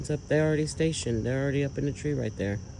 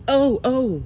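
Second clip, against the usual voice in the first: high and louder, sudden alarm; startled; 7 semitones higher, 4 dB louder than usual. Real, not acted. Filming the sky, startled by a sudden flash. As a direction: jolt at something unexpected.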